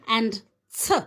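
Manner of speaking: usual speaking voice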